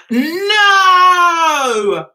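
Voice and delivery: long, high, loud no; dismayed wail. Real, not acted. To viewers on a character coming back, dismayed wail. Upset that Aidan returns.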